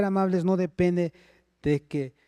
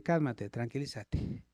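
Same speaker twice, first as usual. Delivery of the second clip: soft and slow, calming a shouting wife; calm soothing; 5 semitones lower, 8 dB quieter than usual. Shown as a demonstration. Husband soothing his shouting wife, soft and slow. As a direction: settle her down gently and slowly. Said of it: "quédate callado y dile".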